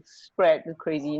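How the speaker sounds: ordinary delivery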